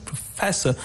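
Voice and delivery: "professor" with FES stressed; second-syllable stress. Shown as a demonstration. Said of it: "the stress is on the second syllable".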